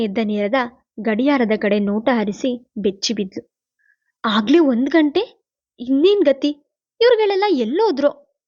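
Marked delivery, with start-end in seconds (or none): 4.2-8.4 rising panic, the last question much higher; a young woman alarmed at the late hour; panicked. A narrator's reading. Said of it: "ಗಾಬರಿಯಿಂದ".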